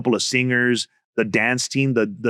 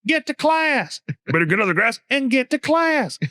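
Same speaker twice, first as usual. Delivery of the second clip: much higher principal voice; principal impression; 12 semitones higher than usual. Comic mashing both catchphrases in the principal's high voice. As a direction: squeak it like the principal. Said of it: "this guy going".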